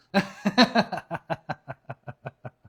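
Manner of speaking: big burst of laughter; loud laugh. Real, not acted. Husband laughs at her eating.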